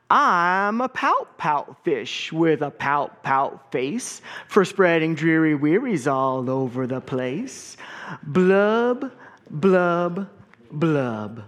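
slow, mopey sing-song fish refrain; glum pout fish. Acted. Gloomy fish chanting his refrain to his friends, slow and mopey. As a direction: drag out the gloomy fish's chant. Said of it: "there's a rhythm to this".

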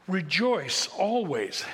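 slow, weighty reading of "Rejoice always."; solemn scripture reading. Shown as a demonstration. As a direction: read "Rejoice always." slowly, each word given weight. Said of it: "First Thessalonians five sixteen".

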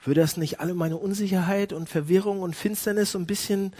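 ordinary talk

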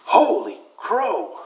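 slow, drawn-out exclamation; imitating an elderly parishioner, each word dragged out; retold old grandma. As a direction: stretch both words out in stunned, slow wonder. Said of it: "Then she said".

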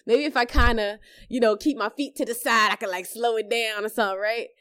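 high, loud, anxious self-calming; nervous reassurance. Retold or imitated. Mom on the slide steadying her own nerves. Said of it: "So I'm just like".

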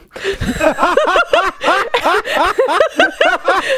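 long, high peal of laughter; laughing. Real, not acted. Friend cracks up at the class system outburst, high laughter. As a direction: burst out in high, sustained laughter.

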